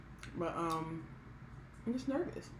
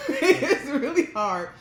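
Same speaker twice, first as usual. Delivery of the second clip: laughing; 11 semitones higher, 12 dB louder, much slower than usual. Real, not acted. Laughing about hard pre-op diet.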